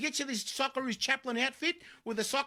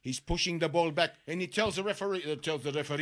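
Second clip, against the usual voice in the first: put-on Schwarzenegger accent; 6 semitones lower than usual. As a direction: call the play like the Terminator. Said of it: "you're sounding like Arnold Schwarzenegger".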